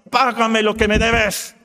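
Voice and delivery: acted servant demanding his money; harsh demand. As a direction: squeeze the debt out of him. Servant throttling debtor demands payment.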